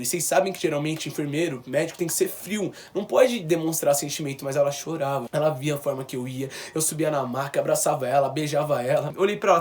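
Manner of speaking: ordinary talk, nothing marked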